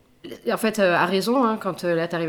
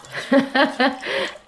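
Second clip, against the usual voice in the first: high, bright laugh; burst of laughter; 7 semitones higher than usual. Real, not acted. Laughs after the story.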